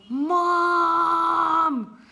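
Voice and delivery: long, high shout for mom; calling out. Acted. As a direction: holler it across the airport, drawn out. Calls out to mom in story.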